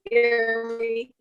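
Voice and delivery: normal talking voice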